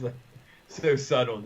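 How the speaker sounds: ordinary talk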